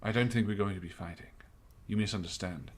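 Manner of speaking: lightly voiced captain, calm and grave; understated officer with grave knowledge; measured captain. Game master voices the captain answering.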